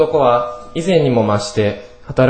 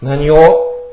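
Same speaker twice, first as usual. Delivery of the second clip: defiant retort; 2 semitones higher, 4 dB louder than usual. A narrator's reading. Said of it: "貧乏神も負けていません".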